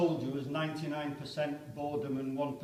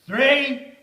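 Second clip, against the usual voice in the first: much higher, loud distant yell; far-off officer's shout; shouted count; 10 semitones higher, 13 dB louder than usual. Acted. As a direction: bellow the number down the line. Countdown called in trench.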